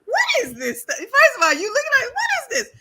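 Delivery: high, laughing what-is-this; amazed giggle at a drink; laughing disbelief. Retold or imitated. Reacting to Piangela's drink, high and laughing.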